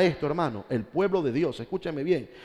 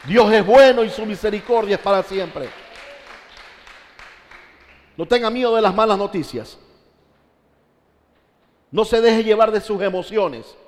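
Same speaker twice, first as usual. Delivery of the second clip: loud, raised-pitch exhortation; full-force preaching; 6 semitones higher, 7 dB louder than usual. Real, not acted. Preacher urging congregation not to fear bad news, full-force preaching. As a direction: proclaim it forcefully to the whole church.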